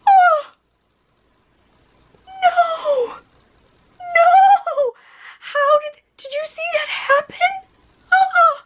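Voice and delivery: very high, anguished little squeals; squealing dismay. Real, not acted. To viewers over her broken nail, high squealing dismay.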